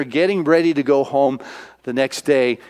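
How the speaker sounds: ordinary talk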